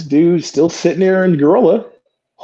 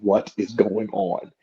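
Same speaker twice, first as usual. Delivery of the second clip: much softer, lower half-whispered grumble; muttered; 6 semitones lower, 9 dB quieter than usual. Retold or imitated. Fed-up wrestler in the ring, quiet low grumble. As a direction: grumble under the breath. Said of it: "And I'm like".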